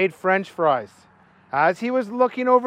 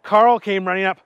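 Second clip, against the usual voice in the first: ordinary delivery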